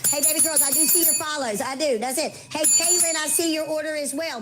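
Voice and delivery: ordinary delivery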